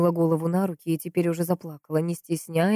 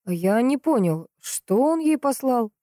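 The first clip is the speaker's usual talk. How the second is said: slow, puzzled question, rising higher; bewildered; 7 semitones higher, 3 dB louder, slower than usual. A narrator's reading. Investigator asks about the gift, bewildered. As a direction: draw it out, lost for understanding. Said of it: "Следователь явно был растерян".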